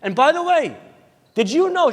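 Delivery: high, emphatic big reveal; acted breaking-news excitement; excited mother. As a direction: announce astonishing news to your son.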